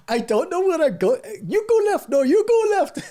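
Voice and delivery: a bad jokey Indian accent, two guys arguing over directions; mock Indian accent. Joking about rover tracks, mock Indian accent squabble. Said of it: "I can't do the accent".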